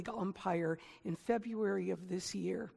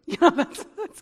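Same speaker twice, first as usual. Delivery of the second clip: laughing; 7 semitones higher, 12 dB louder than usual. Real, not acted.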